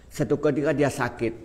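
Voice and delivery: normal talking voice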